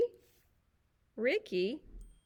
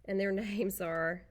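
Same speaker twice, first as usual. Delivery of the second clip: ordinary delivery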